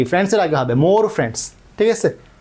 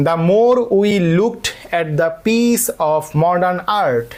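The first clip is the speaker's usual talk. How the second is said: stretched-out reading of the test sentence; teacher reading an exam item slowly; slow dictation; much slower than usual. Shown as a demonstration. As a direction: read it slowly so the class can follow. Teacher reads quiz sentence slowly.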